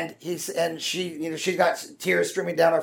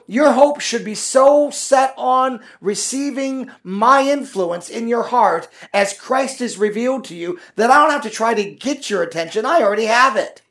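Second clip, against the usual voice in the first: voice of God; 6 semitones higher, 4 dB louder than usual. Retold or imitated. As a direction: lightly voice God addressing the believer. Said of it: "He's saying".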